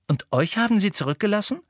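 high, quick amazed question; astonished. A narrator's reading. Ginny hearing about the centaurs, astonished. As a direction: ask it in disbelief. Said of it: "fragte Ginny erstaunt".

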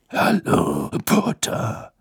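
rough growled greeting. A narrator's reading. Moody greets Harry with a growl. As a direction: growl it like a gruff old auror. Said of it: "knurrte Moody".

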